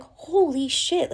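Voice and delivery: high, slow, drawn-out amazement; awed realization. Retold or imitated. Speaker realizing her body is a multiverse, awed and slow. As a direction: let the discovery hit you slowly.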